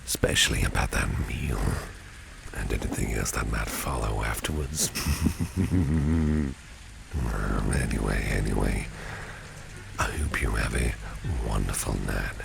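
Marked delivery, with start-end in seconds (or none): 4.9-7.1 higher-pitched rolling chuckle; long, teasing chuckle; suggestive laugh. Acted. Boss hints at what follows the meal, suggestive laugh.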